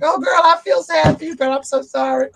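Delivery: high, hammed-up weepy voice; mock crying. Movie fan sympathizing with Rizzo during her song, mock crying. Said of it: "I'm, like, crying".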